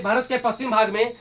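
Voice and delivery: ordinary talk, nothing marked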